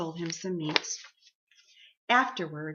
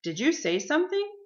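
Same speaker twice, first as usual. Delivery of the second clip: higher, innocent rising question; pretending not to notice; 9 semitones higher, 5 dB louder than usual. A narrator's reading. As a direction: ask as if you heard nothing. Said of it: "pretended not to notice".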